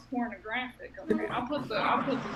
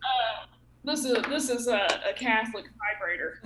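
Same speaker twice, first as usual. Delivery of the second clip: ordinary talk, nothing marked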